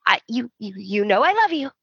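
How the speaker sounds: hammed-up tearful plea, fighting tears; mock teary stammer. Mocking Taylor pleading with Ridge, teary stammer. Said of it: "blinking, holding back the tears".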